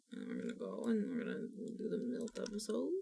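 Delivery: hushed, secretive whisper. Pretend. Telling viewers about the Milt episode, a conspiratorial whisper.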